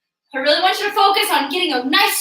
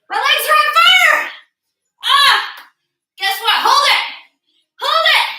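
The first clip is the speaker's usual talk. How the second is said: straining excitement; 11 semitones higher, 4 dB louder than usual. Real, not acted. Coach straining mid-workout.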